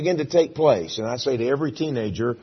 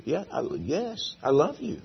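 soft earnest reply; 4 semitones higher, 6 dB quieter than usual. Acted. Peter answering softly.

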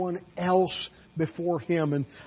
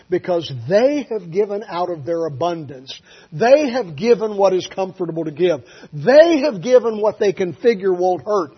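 rising louder sermon build; building preaching; 3 semitones higher, 9 dB louder than usual. Real, not acted. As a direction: preach it up step by step.

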